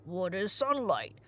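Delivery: a lightly voiced simple giant, puzzled; Cyclops voice.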